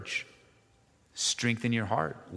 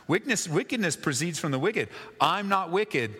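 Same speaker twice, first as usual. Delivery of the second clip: higher-pitched, lightly acted pointed retort; mild rebuke; 6 semitones higher than usual. David hinting at Saul's own wickedness, mild rebuke. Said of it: "just a little mild rebuke".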